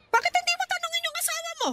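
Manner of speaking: high, pointed challenge; challenging taunt. Acted. Mother taunts daughter to confront her husband, pointed. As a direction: dare her to ask him.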